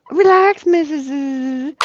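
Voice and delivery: high, drawn-out put-on nurse voice; imitated nurse. Nurse to labouring mother, imitated high drawl. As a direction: imitate a nurse telling a patient to settle down.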